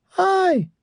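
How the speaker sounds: very high, slow falsetto greeting; high-pitched voice. A narrator's reading. Huge man greets Belaine in a squeaky high voice. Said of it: "he said in a high-pitched voice".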